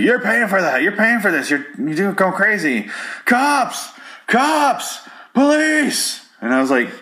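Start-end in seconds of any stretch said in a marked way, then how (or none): 0.0-2.9 high-pitched indignant bartender rant; yelling bartender. Retold or imitated. Bartender yells at the man who shot the TV, mock yelling. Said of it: "yells at him to pay". 3.2-6.3 very high-pitched call for help; mock yelling. Bartender calls for the cops after the shooting, mock yelling. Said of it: "yells at him to pay".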